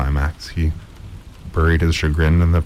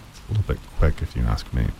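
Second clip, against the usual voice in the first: low, soft muttered aside; a dry comment under the breath; softly skeptical aside; quiet aside; 5 semitones lower, 6 dB quieter than usual. Real, not acted.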